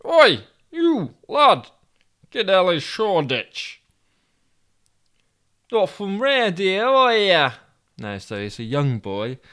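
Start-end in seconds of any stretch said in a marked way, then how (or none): 0.0-3.8 louder, much higher Yorkshire hail; Toby hails a paper boy; calling out. Acted. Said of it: "Toby called out to him". 5.7-7.5 put-on much higher, slow London boy's voice; high-pitched young boy. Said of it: "therefore high-pitched voice".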